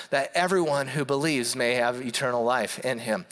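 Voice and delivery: scripture reading. Acted. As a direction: read the verse steadily to the congregation. Reads scripture aloud.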